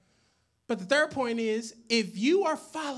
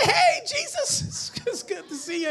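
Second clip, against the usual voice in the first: casual surprised greeting; 12 semitones higher than usual. Acted. Acts out bumping into Jesus.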